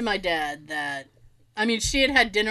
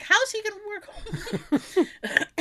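incredulous retort; 9 semitones higher, 4 dB quieter, much slower than usual. Retold or imitated. Incredulous at mom's question.

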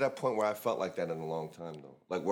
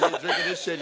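normal talking voice

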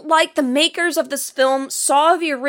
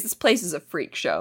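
lower, softer flat read of the line; deadpan quote; 9 semitones lower, 6 dB quieter than usual. Retold or imitated. Host quoting Carly's line, lower and deadpan. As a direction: drop it dry and bored. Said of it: "all you have to say is".

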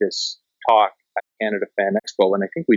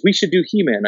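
eager pitch; 8 semitones higher than usual. Retold or imitated. Isaac pitching He-Man in car.

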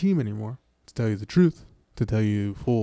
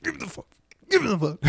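very high, strangled imitation yell; mock screaming; 10 semitones higher, faster than usual. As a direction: imitate a furious scream in a squeezed high pitch. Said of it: "he's screaming".

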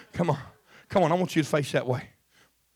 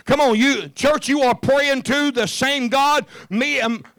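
loud, high, fiery preacher shout; preaching at full force; 12 semitones higher, 7 dB louder than usual. Acted. Pastor urging the church to open their mouths, shouting. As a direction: preach it out to the church, all fire.

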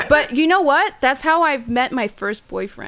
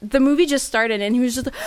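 ordinary talk, nothing marked

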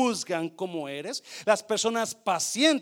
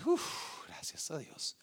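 whispered relief; 3 semitones lower, 7 dB quieter, slower than usual. Retold or imitated. Relief while nervous.

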